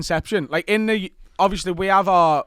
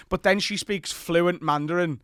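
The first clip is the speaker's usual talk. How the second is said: ordinary talk, nothing marked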